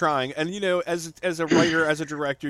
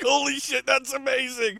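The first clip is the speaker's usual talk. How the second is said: delighted exclamation; 10 semitones higher than usual. Retold or imitated. Retelling delight at a line.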